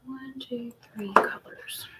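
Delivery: very soft, whispered count; counting under breath. Real, not acted. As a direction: whisper it to yourself as you work. Counting to herself while working.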